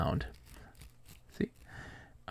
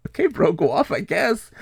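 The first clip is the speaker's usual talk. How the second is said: higher, eye-rolling brush-off; mock dismissive; 9 semitones higher, 5 dB louder than usual. Retelling the guy's snide exit, mock dismissive. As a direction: say it like you're done with him.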